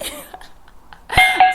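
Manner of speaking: high, breathy laugh; burst of laughter. Real, not acted. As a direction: laugh out loud at yourself. Laughs at own accent.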